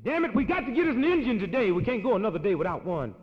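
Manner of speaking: mock-furious boss yelling; angry shout. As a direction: pound the table and shout it. Father shouting at meeting.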